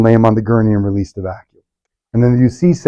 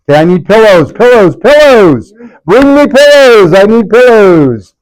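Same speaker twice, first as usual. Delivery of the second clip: calling out; 9 semitones higher, 6 dB louder than usual. Real, not acted. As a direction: call across the room for pillows. Calling for pillows to be brought.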